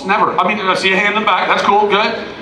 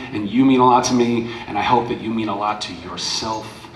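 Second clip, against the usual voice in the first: soft and low; soft, heartfelt closing words; tender; 6 semitones lower, 6 dB quieter than usual. Real, not acted.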